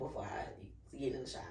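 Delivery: hushed whisper to herself. Retold or imitated. She whispers her plan before the shower. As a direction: whisper it under your breath.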